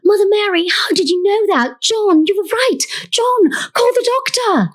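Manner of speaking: panicked, very high British shriek; hammed-up British mother in a panic; Florence. Mother overhearing her daughters, high theatrical panic. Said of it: "eyes bulging with panic".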